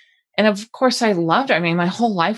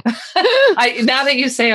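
laughing; 7 semitones higher, 3 dB louder, slower than usual. Real, not acted. Bursts out laughing.

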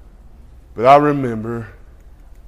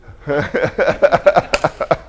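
a long real laugh at his own joke; hearty laugh. Real, not acted.

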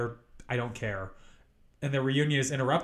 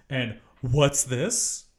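slow dramatic suspense; much slower than usual. Acted. Dramatic storytelling reveal.

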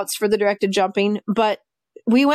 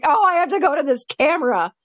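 lightly voiced complaining dog, higher; mildly put-on grumble for the dog; reluctant dog's voice; 6 semitones higher than usual. Handler voicing her camera-hating dog, lightly acted complaint.

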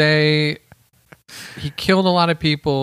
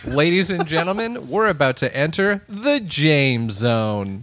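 slow drawn-out announcer intro; 3 semitones higher, slower than usual. Pretend. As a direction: stretch it like a showman. Announcer intro, third try.

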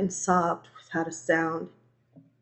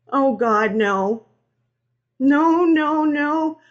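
rising, louder dread; dawning horror; 7 semitones higher, 7 dB louder, much slower than usual. A narrator's reading. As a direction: plead against what you are seeing. Realizing the wreck is girlfriend's.

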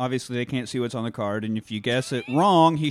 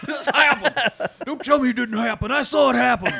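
put-on southern accent; 9 semitones higher, 4 dB louder than usual. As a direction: lay on a southern accent, indignant. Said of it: "Why did he get so southern?".

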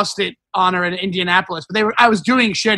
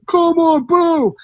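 high-pitched mock heckle; heckling lady; 8 semitones higher, much slower than usual. Lady heckles a Trump joke, high drawn-out booing. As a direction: imitate a woman booing from the crowd. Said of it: "this lady goes".

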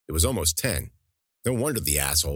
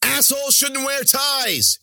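much higher, louder, slow triumphant shout; gleeful cry; 5 dB louder, slower than usual. Acted. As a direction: crow it while being carried out laughing. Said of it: "she cried".

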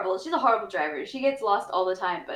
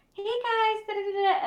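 high cheerful sing-song; happy mom greeting; 13 semitones higher than usual. Retold or imitated. Mom greeting kids cheerfully, high and bright. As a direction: chirp hello like mom.

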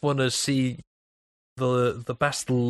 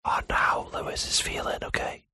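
breathy ASMR whisper; 4 semitones higher than usual. Pretend. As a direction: whisper close to the mic. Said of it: "Whisper me your dreams".

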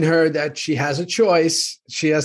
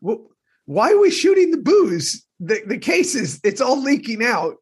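high-pitched, exasperated protest; incredulous complaint; 10 semitones higher than usual. Real, not acted. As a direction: object in high-pitched disbelief. Mock outrage at shooting the booze.

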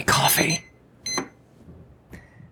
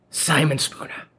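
ordinary talk

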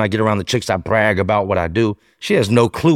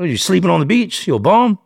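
mocking detective; 9 semitones higher, 3 dB louder than usual.

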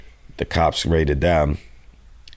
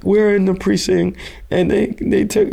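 high, louder, stammering panic; panicked girl; 3 dB louder than usual. Retold or imitated. Girl in the precinct panics to the dealer on the phone, high and stammering. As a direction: blurt it out, pitched up and frantic.

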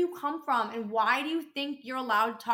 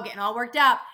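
worked up; 7 dB louder than usual. Real, not acted. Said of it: "excuse me, here I go".

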